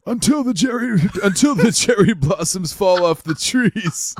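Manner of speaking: put-on a strained old geezer, like the listener's "constipated voice"; old man voice. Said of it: "let me do my old man voice".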